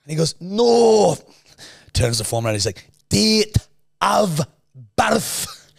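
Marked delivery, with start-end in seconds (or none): 0.4-1.2 high, loud Scottish no; imitating the Scottish nurse's firm no; Scottish accent. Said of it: "most thick Scottish fucking accent". 3.1-5.7 slow, high, loud Scottish nurse; mock Scottish nurse repeating date of birth; Scottish accent. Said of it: "most thick Scottish fucking accent".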